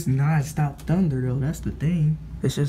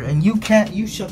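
calling out; 6 semitones higher, 5 dB louder than usual. Real, not acted. Yelling at sister.

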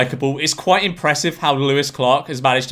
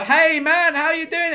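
much higher, casual put-on voice for the pickpocket; friendly stranger greeting; 12 semitones higher, slower than usual. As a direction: play the pickpocket's fake-friendly hello. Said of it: "Four guys come up to us and go".

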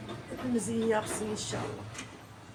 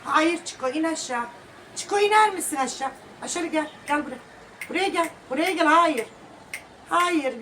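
high, loud stern calls to a dog; firm raised voice ordering a pet down; calling the dog; 6 semitones higher, 7 dB louder than usual. Real, not acted. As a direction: shout the dog down off the chair. Scolding dog off the chair.

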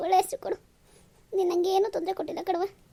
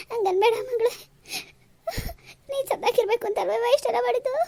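higher pitched, sobbing through the words; crying; 7 semitones higher than usual. Acted. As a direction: weep as you plead with your daughter. Mother weeping at daughter's departure. (ಅಳುತ್ತಾ ಬೇಡಿಕೊಳ್ಳುವ ಧ್ವನಿ)

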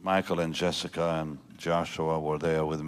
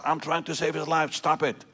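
high-pitched mock scream, not much louder; retold scream; 10 semitones higher, 4 dB louder than usual. Nurse to another nurse while trying to save a life, retold scream. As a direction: imitate a nurse screaming at a colleague. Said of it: "and she screamed at her".

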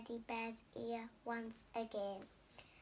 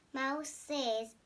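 usual speaking voice

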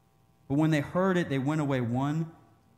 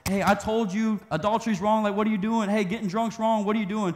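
higher, louder preachy scolding; mock self-righteous scold, pitched up; Bible thumper; 7 semitones higher, 4 dB louder than usual. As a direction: wag a finger at a sinner. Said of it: "being a Bible thumper".

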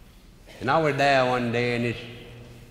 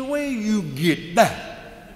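incredulous challenge; 9 semitones higher, much slower than usual. Retold or imitated. Manager challenging the umpire.

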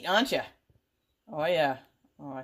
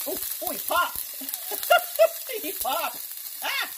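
surprised squeal; 3 dB louder than usual. Real, not acted. Worm pops in pan.

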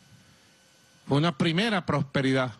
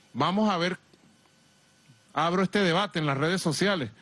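ordinary talk

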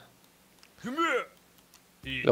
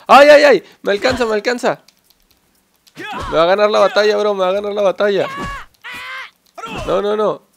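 high, loud panicking cries; panicked yelps; 6 semitones higher, 6 dB louder than usual. Real, not acted. Gamer as Hana catches up, real panicked yelling. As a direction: yelp in real alarm as you lose ground. Opponent closing in.

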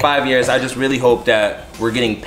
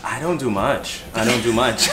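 ordinary talk, nothing marked

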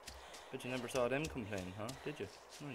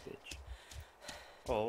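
ordinary talk, nothing marked

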